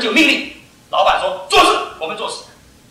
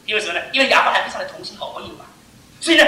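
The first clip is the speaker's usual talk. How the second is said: normal talking voice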